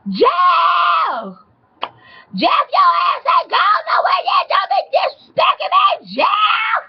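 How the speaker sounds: high-pitched screeching yell; imitated high hollering; hollering angry woman. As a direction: holler across the yard at your man, shrill and furious. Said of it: "she hollering".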